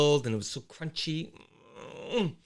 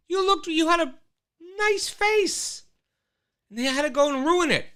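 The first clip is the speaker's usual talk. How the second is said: dismayed plea; 12 semitones higher than usual. Real, not acted. Pleading to absent Sharon Osbourne.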